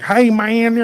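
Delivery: high-pitched mumbling country guy, imitated; hammed-up country talk-show caller; backwoods cousin type. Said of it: "Sounds like one of our cousins".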